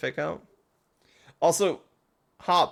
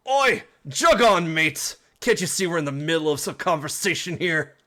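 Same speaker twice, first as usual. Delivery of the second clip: put-on British accent; 3 semitones higher, 5 dB louder than usual. Team Yell grunt shooing Hop away, loud Cockney accent. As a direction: shoo them off in a rough British accent. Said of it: "It's English, but with an accent".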